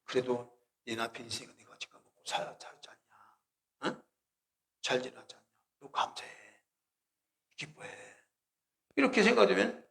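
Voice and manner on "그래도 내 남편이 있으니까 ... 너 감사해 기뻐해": soft near-whisper of conscience; soft, gentle inner prompting; hushed inner voice. Retold or imitated. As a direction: breathe it gently to yourself. Holy Spirit's inner nudge.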